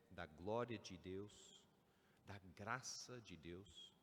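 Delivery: normal talking voice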